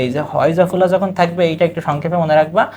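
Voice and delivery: ordinary delivery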